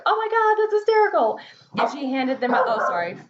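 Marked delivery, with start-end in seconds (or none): 0.0-1.4 high, gushing oh my God; delighted customers. Retold or imitated. Clients seeing the mugs, gushing high and delighted. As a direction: squeal it like a thrilled customer. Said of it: "people are like".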